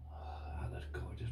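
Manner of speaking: soft breathy whisper of admiration; hush, marvel at it; awed whisper. Real, not acted. Admiring the key to viewers, awed whisper.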